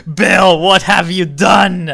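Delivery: high, loud, drawn-out outcry; mock-dramatic shout. Streamer reproaching Bill in the game, mock-dramatic shout.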